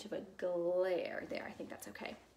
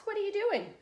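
high, loud, lively question; curious fox; 13 semitones higher, 8 dB louder than usual. Acted. Fox asking passing Bird, high lively question. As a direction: ask it perkily, way up high.

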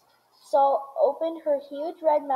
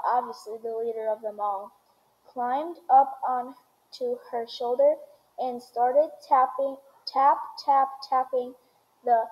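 ordinary delivery, nothing marked

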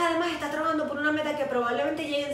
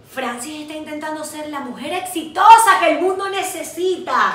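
loud, drawn-out mock grandeur; big slow mock-heroic announcement; self-mocking proclamation; 4 semitones higher, 10 dB louder than usual. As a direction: proclaim it slowly, hammed up. Mocks her own grand ambition.

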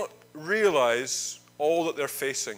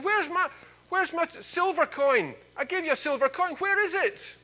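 much higher, strained demand for the coin; angry demand; 11 semitones higher than usual. Acted. Forgiven man seizes his debtor by the lapels, high and angry. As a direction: grab him and demand it back.

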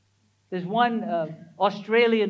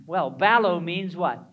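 ordinary talk

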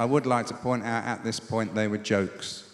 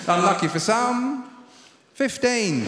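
higher announcing bingo call; bingo caller; 8 semitones higher than usual. Acted. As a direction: call it out to the hall. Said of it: "update the bingo calling".

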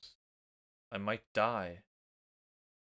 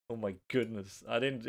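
ordinary delivery

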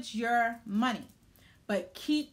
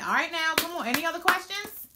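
high, loud hype; rally the room; excited; 8 semitones higher, 6 dB louder than usual. Real, not acted. Energetic host rallying audience.

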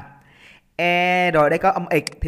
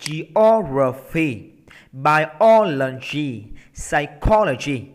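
slow "Geography, biology, psychology", each punched on the second syllable; drawn-out stressed O in each of "Geography, biology, psychology"; 4 dB louder, much slower than usual. Shown as a demonstration. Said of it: "Đây là điều là nhấn âm hai hết".

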